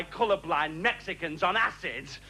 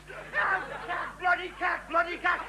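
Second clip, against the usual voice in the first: higher, shouting cack cack; ranting refrain; 6 semitones higher, slower than usual. Acted. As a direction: bark it in disgust.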